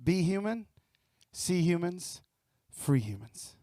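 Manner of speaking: slow, measured closing chant; slogan cadence. Acted. Speaker closes his talk with the motto, slow measured chant. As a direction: land the motto phrase by phrase.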